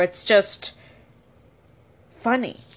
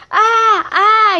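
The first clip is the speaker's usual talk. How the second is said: high, louder hammed-up ah cries; mock crash cries; 10 semitones higher, 5 dB louder than usual. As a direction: play at panicking in a crash. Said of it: "He began to go".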